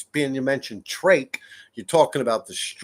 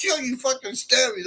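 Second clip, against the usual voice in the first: much higher, yelled mock outrage; a high, cracked, laughing yell; mock yelled accusation; 11 semitones higher than usual. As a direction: shout it like the wronged friend, hamming it up. Retold accusation, laughing hard.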